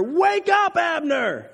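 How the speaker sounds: loud, high, drawn-out holler; shouting down. A narrator's reading. David yells down from the hill to Abner, shouting. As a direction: call out to wake someone far off. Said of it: "he shouted down to the soldiers".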